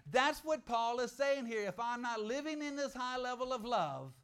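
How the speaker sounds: usual speaking voice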